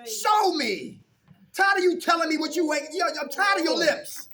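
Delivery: fed-up Ma laying into him; mother telling her son to prove it, loud and high; scolding mother. Retold or imitated. Voicing his mother scolding.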